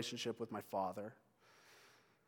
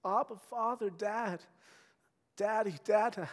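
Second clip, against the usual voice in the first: higher, softening with each name; childlike calling; 8 semitones higher than usual. Pretend. Believers calling God like a child, tender. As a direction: call him like a little child.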